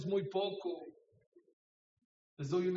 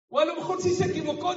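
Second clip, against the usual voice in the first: high, loud and quick; emphatic; 11 semitones higher, 10 dB louder, faster than usual. Real, not acted. Preacher pressing congregation to speak up, loud. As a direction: burst out with the point.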